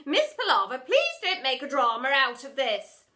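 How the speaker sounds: loud firm plea; loud warning. Acted. Headmistress warning the teacher, loud. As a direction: warn her firmly.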